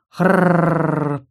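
long rasping monster groan. Acted. Bloated monster Arakarna groans lying on her back. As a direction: drag out a heavy rolling growl-moan. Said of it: "простонала Аракарна".

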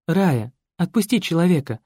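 ordinary delivery

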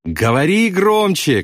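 slow high-pitched call to be heard; shout. A narrator's reading. Pilot demands bombardier speak up, high shout. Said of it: "крикнул Кроха Сэмпсон".

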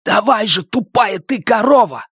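high stifled shout at his sister; whispered yell. Acted. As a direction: yell at her but keep it hushed. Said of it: "шёпотом заорал Бенито".

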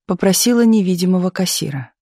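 usual speaking voice

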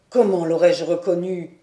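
voix grave et forte, chaleureuse et encourageante; vieux docteur ému. A narrator's reading. (le docteur félicite Diane, voix grave et émue)

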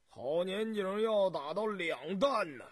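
put-on emphatic old man correcting a guest; old farmer. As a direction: pipe up loudly as an old farmer. Old farmer boasting of good harvest.

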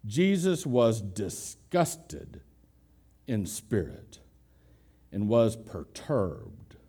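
"disgusted" and "perturbed" said very slowly and weightily; slow emphatic reading. Shown as a demonstration. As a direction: lean hard on each word, very slow. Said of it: "the words that John uses are these".

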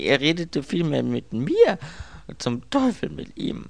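high, agitated cursing; upset, voice climbing high; exasperated outburst. Acted. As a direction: correct him, then curse in frustration. Exasperated, curses the man.

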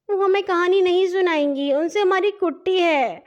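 put-on high, pouting little girl. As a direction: sulk like a child who has fallen out with her sister. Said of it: "وہ منہ بنا کر بولی". (منہ بنا کر بچکانہ شکایت)

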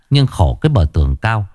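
usual speaking voice

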